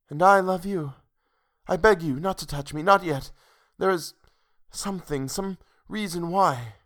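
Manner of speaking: high, pleading, halting; sweet, fearful pleading; frightened heroine. A narrator's reading. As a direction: beg him gently to wait, pitched high. Heroine begs, searching for a reason.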